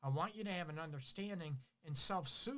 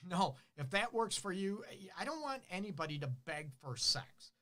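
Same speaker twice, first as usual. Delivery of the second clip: ordinary talk, nothing marked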